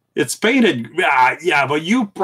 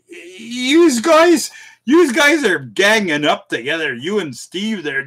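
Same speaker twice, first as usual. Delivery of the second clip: mock protest; 8 semitones higher, 6 dB louder than usual.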